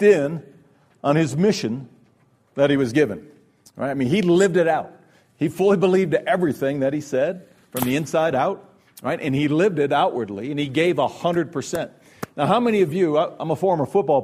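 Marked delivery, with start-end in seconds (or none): none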